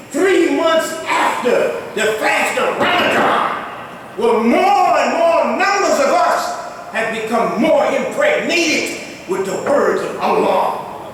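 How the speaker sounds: ordinary delivery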